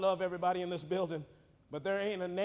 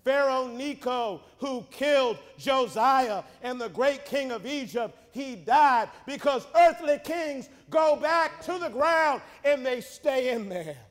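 full-force preaching; 6 semitones higher, 10 dB louder than usual. Acted. Preacher tells congregation what God said, full-force preaching. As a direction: build to a loud, raised proclamation.

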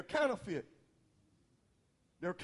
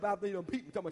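ordinary talk, nothing marked

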